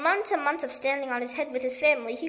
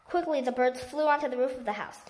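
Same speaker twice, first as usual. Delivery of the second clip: ordinary delivery, nothing marked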